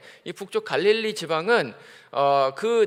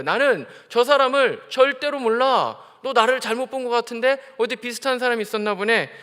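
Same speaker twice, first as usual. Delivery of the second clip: Peter denying; 5 semitones higher, 5 dB louder than usual. Acted. Peter denies knowing Jesus.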